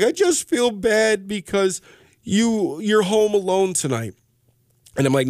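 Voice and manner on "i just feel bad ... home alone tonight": put-on higher, slow, lightly tearful child's voice; a hint of a weepy young girl; imitating crying daughter. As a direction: gently mimic his daughter's teary apology. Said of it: "she starts crying".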